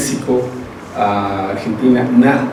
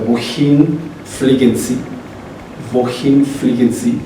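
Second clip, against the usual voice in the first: slow model question; slower than usual. Shown as a demonstration. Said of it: "teacher models German question".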